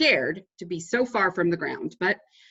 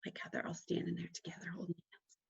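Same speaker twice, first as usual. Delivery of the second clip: unvoiced, much softer aside to the listener; whispered aside; 16 dB quieter than usual. Real, not acted. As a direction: whisper a little remark.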